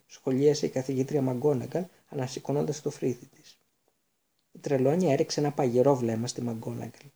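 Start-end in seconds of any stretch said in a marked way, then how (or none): none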